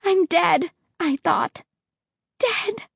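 high, slow hopeless voice; despair. Acted. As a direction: realize doom in terror. Despairing thought.

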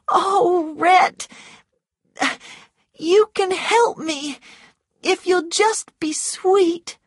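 high, loud coaxing woman; wheedling Scarlett, pleading. A narrator's reading. Scarlett begs Rhett for help, wheedling. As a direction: beg sweetly in a high-pitched voice.

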